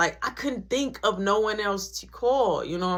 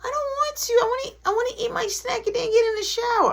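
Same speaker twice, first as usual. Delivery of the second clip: put-on high whining little kid; whiny kid brother; 3 dB louder, faster than usual. Little brother refusing the shower, high whine. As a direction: whine it like a stubborn child.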